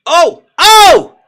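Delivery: two very loud, high "oh!" cries; excited shouts. Real, not acted. Fan watching a shot, loud high shouts. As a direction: erupt at a possible goal. Near goal in match.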